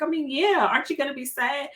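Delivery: lightly voiced high daughter; a hint of the teen daughter asking; imitating daughter. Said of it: "She was like".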